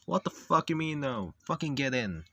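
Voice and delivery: loud, indignant order; angry outburst. Acted. Ordering the refusing character in, angry outburst. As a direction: snap back loudly in outrage.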